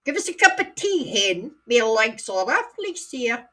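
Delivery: high, lilting Scottish granny voice. Retold or imitated. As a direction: voice an old Edinburgh granny asking for tea. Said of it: "So she used to say".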